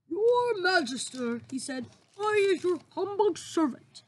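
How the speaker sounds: high, slow giant voice; humble giant. Acted. The BFG greeting the Queen humbly, giant voice.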